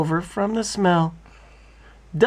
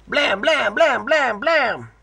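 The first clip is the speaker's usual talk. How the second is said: higher, punchy blam knocks; reading the friends banging on the door; knocking sound; 7 semitones higher, slower than usual. A narrator's reading. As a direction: pound out each knock. Knocking sound read aloud.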